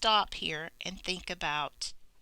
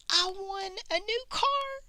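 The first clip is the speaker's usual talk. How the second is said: much higher, thrilled squeal; excited exclamation. Shown as a demonstration. Calling a friend about a new car, thrilled squeal. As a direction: gush like you just got the keys. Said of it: "You hear the emotion in there".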